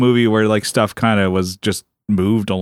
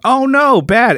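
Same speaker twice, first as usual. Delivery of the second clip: sarcastic "oh no" jumping way up, loud; mock dismay; 11 semitones higher, 4 dB louder, slower than usual. Mocking the movie's shrug at a death, in high fake dismay. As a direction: pitch it high and fake-upset, then drop it.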